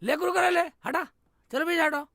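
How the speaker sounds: usual speaking voice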